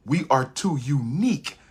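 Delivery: ordinary delivery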